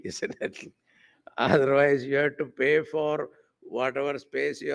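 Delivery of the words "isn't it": breathy chuckled isn't it; laughing aside. Real, not acted. Swami chuckling to his listeners, laughing softly. Chuckling rhetorical tag question.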